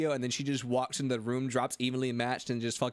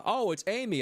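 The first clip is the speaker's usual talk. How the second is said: voiced a lot higher as the ex; chirpy imitation of the ex, pitched up; lightly mocking the ex with a higher voice; 9 semitones higher than usual. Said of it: "they were like".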